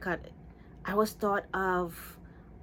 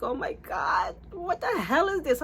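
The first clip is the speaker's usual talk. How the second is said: high, wailing retold dismay; hammed-up horror, pitched way up; near-tears dismay; 10 semitones higher than usual. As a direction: squeal it as if about to cry. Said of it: "I wanted to cry".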